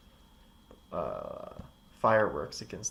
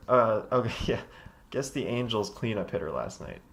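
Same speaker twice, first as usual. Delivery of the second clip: ordinary talk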